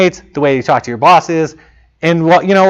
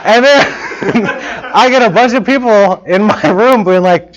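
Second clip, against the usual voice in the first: higher, laughing through the telling; amused, laughing narration; 4 semitones higher, slower than usual. Real, not acted. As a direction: tell it while cracking up. Laughing at his own story.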